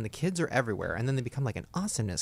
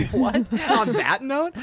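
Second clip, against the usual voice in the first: high, drawn-out disbelief; incredulous; 11 semitones higher, 8 dB louder, much slower than usual. Real, not acted. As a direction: stretch it out in disbelief. Incredulous at the break line.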